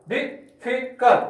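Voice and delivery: difficult slowly split into dif, fi, cult; teacher sounding out difficult in three slow beats; syllable by syllable. Shown as a demonstration. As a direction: pause after each syllable of difficult. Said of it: "तीन syllable है".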